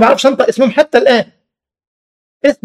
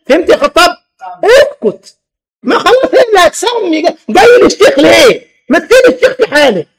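laughing scold; 7 semitones higher than usual. Real, not acted. Teasing scolding of a congregant.

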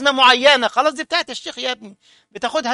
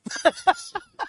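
short burst of high laughter; 3 dB quieter than usual. Real, not acted. Preacher laughing at his own anecdote, high-pitched laugh. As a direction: laugh it off, amused at the memory.

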